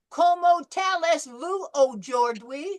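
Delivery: loud, slow Southern drawl on "Comment allez-vous aujourd'hui?" with a hard t and "ou-chor-dwee"; mock a Southern student mangling "Comment allez-vous aujourd'hui?" in a high, loud drawl; Southern American accent mangling the French. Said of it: "people that speak Southern accented English".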